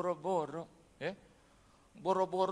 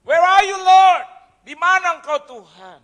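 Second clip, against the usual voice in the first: loud, high cry to God; anguished shouting; 10 semitones higher, 20 dB louder than usual. Retold or imitated. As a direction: shout desperately at heaven, then drop off. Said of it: "saya jalan teriak".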